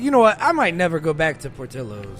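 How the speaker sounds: fed-up declaration; loud declaration. Real, not acted. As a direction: announce it to the room. Announcing a grudge.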